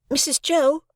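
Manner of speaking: put-on politely, a small boy; young boy Pip. A narrator's reading. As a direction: Pip addresses his sister, high and timid.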